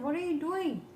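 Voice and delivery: high startled cry; monkey sinking underwater, frightened; alarmed monkey. Acted. Monkey alarmed as crocodile dives.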